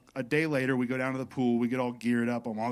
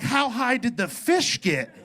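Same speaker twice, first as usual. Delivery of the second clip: slow stoned wonder; 9 semitones higher, 3 dB louder, slower than usual. Retold or imitated. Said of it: "I'm like".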